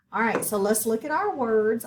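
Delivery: normal talking voice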